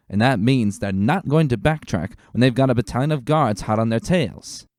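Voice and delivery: rally the troops with a firm officer's call; commanding lieutenant. Acted. Lieutenant ordering stallions to join the forest search, loud and commanding.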